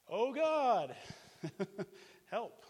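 high, loud half-laughing plea for help; mock desperate cry. Stranded abroad, begging God for help, half-laughing mock cry.

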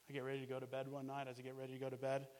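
ordinary delivery

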